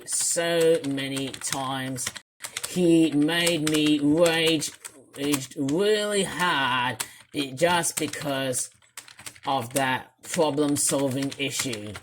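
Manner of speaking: slow, low, worn-out delivery; drained voice. Real, not acted. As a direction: drag it out, spent after raging. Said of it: "just made me nearly drain my voice".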